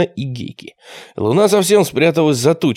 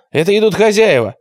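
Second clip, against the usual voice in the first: raised-pitch alarm call; sudden cry; 7 semitones higher, 3 dB louder than usual. A narrator's reading. Lookout warning that owners are coming, a sudden cry. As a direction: cry out a warning, not full volume. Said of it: "крикнул вдруг кто-то".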